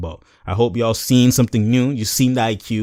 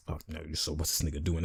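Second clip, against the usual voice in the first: much softer, low mutter under the breath; muttered aside; 6 semitones lower, 15 dB quieter than usual. Retold or imitated. Teammates shaking heads, muttering quietly to themselves. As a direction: grumble it to yourself.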